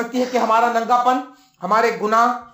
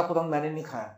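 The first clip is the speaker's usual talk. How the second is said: timid denial; 6 semitones lower, 11 dB quieter, faster than usual. Acted. Adam denies eating the fruit.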